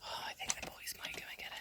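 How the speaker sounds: hushed whisper. Real, not acted. Owner whispering about the boys grabbing it. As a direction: whisper it so they won't hear.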